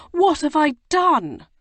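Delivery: the regret breaks out fully; anguished remorse. A narrator's reading. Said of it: "he said remorsefully".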